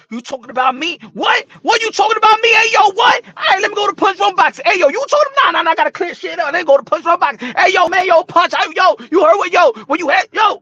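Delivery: high, loud, fast challenge to Punch; mock confrontation. Caller challenging Punch Drunk Boxing, loud and high. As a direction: act out Teofimo Senior calling out the channel, loud and high.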